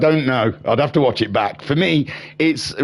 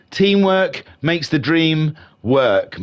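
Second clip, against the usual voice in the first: slow repeat; 4 semitones higher, slower than usual. Acted. Repeating motto slowly.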